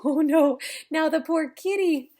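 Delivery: ordinary delivery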